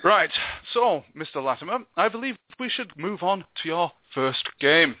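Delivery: ordinary talk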